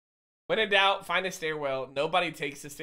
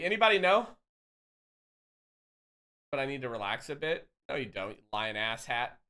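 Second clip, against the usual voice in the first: ordinary delivery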